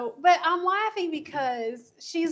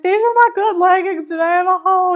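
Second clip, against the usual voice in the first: loud, high, fretting cry; mock distress; 6 semitones higher, 11 dB louder, faster than usual. Friend mimics the fallen rider checking her good leggings, distressed. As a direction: imitate a friend wailing over a small disaster.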